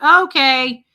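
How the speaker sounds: loud, high retold protest; overwhelmed. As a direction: cut him off, getting emotional. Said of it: "I was just getting really emotional".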